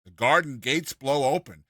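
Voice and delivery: ordinary delivery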